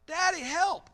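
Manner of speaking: high, loud panicked cry to Dad; child's yell for help. Acted. Preacher voices a child running into traffic, yelling for Daddy. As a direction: yell out like a kid in danger. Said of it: "they yell out".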